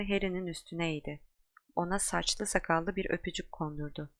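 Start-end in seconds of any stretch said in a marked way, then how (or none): none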